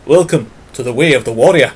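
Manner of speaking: fast, punchy imitation of a Scottish game show host in a Scottish accent. Vlogger imitating the Scottish host of Raven, in a Scottish accent. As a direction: boom out the show's Scottish catchphrase. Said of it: "I think it was Scottish".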